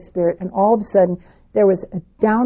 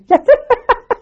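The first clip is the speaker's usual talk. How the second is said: high laugh burst; 7 dB louder than usual. Real, not acted. Laughing at the pajamas remark.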